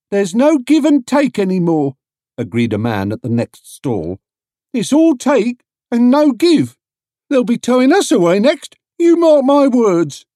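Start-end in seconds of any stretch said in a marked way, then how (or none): 0.1-1.9 higher, louder grumbling trader; a fed-up stallholder griping; market trader voice. A narrator's reading. Trader at next stall complains to Paddington, grumbling. 4.7-10.2 higher, louder gloomy stallholder, slowing at the end; grumbling trader. A narrator's reading. Trader at next stall predicts towing, gloomy warning.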